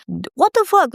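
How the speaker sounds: imitated high-pitched angry outburst; mock someone yelling at you, pitch shooting up. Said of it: "they yelled at me and angry".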